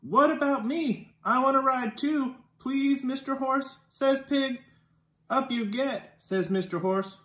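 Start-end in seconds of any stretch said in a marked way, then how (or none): none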